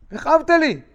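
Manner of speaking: high, loud wounded cry; donkey protesting its rider; pained donkey cry. Acted. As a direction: cry out like a beaten donkey in pain. Donkey's cry acted in story.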